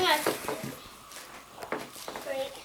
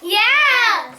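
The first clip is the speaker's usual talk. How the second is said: excited shout; 6 semitones higher, 11 dB louder than usual. Real, not acted. Kids eager to open candy.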